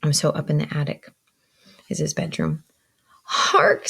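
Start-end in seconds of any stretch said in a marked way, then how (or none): 3.3-3.9 high, sudden exclamation; calling out. A narrator's reading. Pip alerts Joe to a sound, calling out. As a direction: pitch it up like a child alerting someone. Boy calls out in story.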